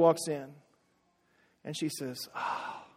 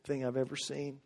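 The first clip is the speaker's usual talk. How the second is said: soft, low, hushed words to a daughter; a father's quiet pride; tender father; 6 semitones lower, 11 dB quieter than usual. Retold or imitated. Father to daughter before the aisle, soft and tender. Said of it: "And he says".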